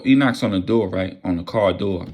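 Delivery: ordinary delivery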